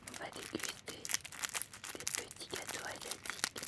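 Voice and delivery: soft unvoiced whisper; ASMR whisper. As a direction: whisper it close to the mic.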